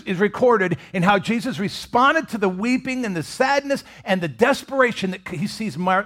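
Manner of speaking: ordinary delivery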